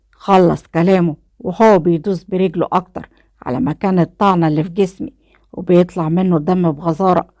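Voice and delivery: ordinary delivery, nothing marked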